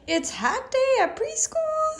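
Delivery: high, slow surprised question; mock surprise. Mother playing amazed to her son, high and slow. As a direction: act amazed for the child.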